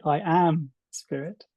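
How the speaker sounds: slow, with "am" lifted and stressed. Shown as a demonstration. Reader showing the weight moved onto "am", slow and stressed. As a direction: draw out "I am spirit" and hit "am". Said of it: "or you can say".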